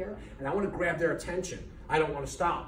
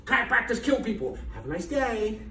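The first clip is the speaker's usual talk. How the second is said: higher, louder, bright salesman-like hello; mock cheery greeting; 8 semitones higher, 4 dB louder than usual. Chiropractor greeting farmers market passersby, higher and cheery. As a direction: greet strangers at a booth with forced cheer. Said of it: "stand there in screenings and say".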